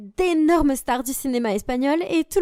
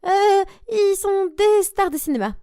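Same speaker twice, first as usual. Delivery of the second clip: higher, slow, panicky fib; panicked; 8 semitones higher, 3 dB louder, slower than usual. Acted. Girl lying to classmates in a panic, higher and slow. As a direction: blurt the lie in a panic. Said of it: "Et j'ai paniqué".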